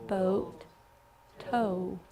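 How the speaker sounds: normal talking voice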